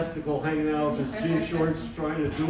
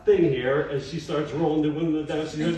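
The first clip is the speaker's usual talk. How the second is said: ordinary talk, nothing marked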